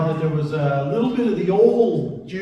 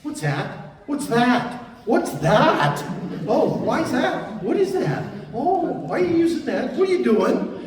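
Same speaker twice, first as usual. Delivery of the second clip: put-on pestering kid questions, pitch climbing higher; lightly voiced children, getting more insistent; imitating curious kids; 6 semitones higher than usual. Father retelling his kids' endless questions at the car, pestering. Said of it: "Oh, they love that question".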